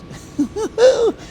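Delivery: very high put-on cackle; mock vampire laugh. Host as vampire Meghan, mock high laugh. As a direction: let out a hammed-up high villain laugh.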